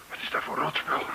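fluisterend, zonder stem; whisper. Acted. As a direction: hees gefluisterd, uitgeput. Gevangene in traangas, fluisterend. (gevangene fluistert in de gaswolk)

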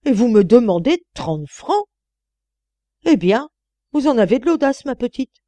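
loud, high indignant outburst; furious scolding old woman; outraged. A narrator's reading. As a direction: blast her for daring to ask. Old lady scolds the maid.

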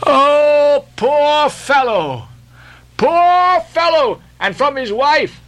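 high, slow wail of pity; drawn-out sorrowful exclamation; sighing lament. A narrator's reading. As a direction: lament him in long, high sighs. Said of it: "sighed Mayhew".

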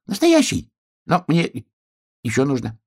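very high, excited little voice; thrilled hedgehog. Acted. Hedgehog admires wolf's boat, thrilled and high. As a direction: marvel in a squeal-high voice.

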